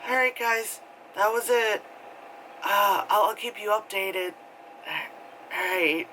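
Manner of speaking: high, falsely bright voice; eerie vlogger sign-off. Retold or imitated. Girl telling her viewers she will keep them updated, high and forced.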